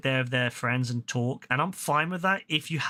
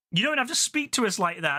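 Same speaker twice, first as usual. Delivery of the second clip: higher-pitched imitation of offended cinemagoers; mock indignation; 7 semitones higher than usual. Told-off group answering back to him, mock indignant. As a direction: mimic their affronted protest, pitched up. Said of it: "they got so mad at me".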